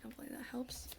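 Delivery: soft whisper. Real, not acted. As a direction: whisper it to yourself. After taking a sip of drink.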